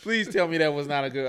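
loud, insistent mock challenge; worked-up retold confrontation. Acting out challenging the young soldiers.

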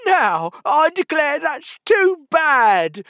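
high, loud, drawn-out outrage; sudden passion. A narrator's reading. Humpty is outraged at being interrupted, sudden passion. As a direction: cry out indignantly, stretching the words. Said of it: "cried, breaking into a sudden passion".

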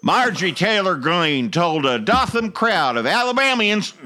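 put-on light Southern accent. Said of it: "we're gonna do it in this accent".

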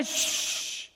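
long hushing "shh"; voiceless shush to silence someone; drawn-out breathy hush. Acted. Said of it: "to shush him".

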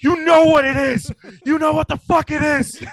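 high, loud fed-up shouting; exasperated yell. Retold or imitated. Worn-out uncle yelling at his toddler niece, high exasperated shout. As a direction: snap at the kid who keeps asking. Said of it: "I'm like".

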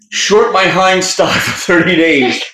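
loud, high, drawn-out call; hammed-up slow shout; mock trader's order. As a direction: call it out slowly like an order across a floor. Acts out urgent stock order by phone.

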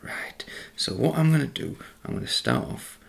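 soft unvoiced whisper; hushed ASMR talk. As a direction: whisper it close to the mic. Whispered cooking chat.

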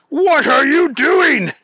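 loud, high outraged shout; indignant yell. A narrator's reading. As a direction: shout it in alarm, pitch jumping up. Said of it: "Neville shouted".

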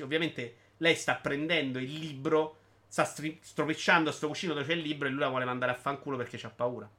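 ordinary delivery

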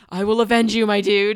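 loud, hammed-up vow of vengeance; mock upset vow. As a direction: proclaim it like a grieving movie hero. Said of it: "he's so upset".

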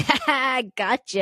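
high, loud fake laugh into a taunt; spring the prank with a hammed-up cackle; imitated prankster's reveal; mock gloating.

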